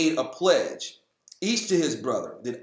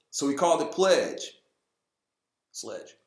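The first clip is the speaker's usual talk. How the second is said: ordinary talk, nothing marked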